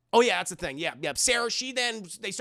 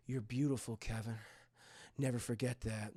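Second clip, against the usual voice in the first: very soft, low self-admiring murmur; psychopath; 7 semitones lower, 10 dB quieter than usual. Retold or imitated. Man admiring himself in the mirror, hushed creepy voice. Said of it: "this guy's a fucking psychopath".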